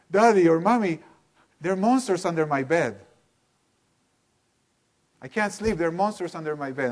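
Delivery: put-on high, loud frightened child calling out; scared child calling out in the night, high and loud; crying, scared child. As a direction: cry out to the parent about the monsters, high and loud. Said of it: "And they're crying".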